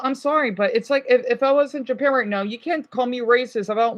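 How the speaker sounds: usual speaking voice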